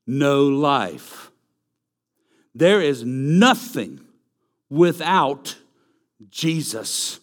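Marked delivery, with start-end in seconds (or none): none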